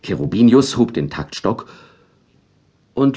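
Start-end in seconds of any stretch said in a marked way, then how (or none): none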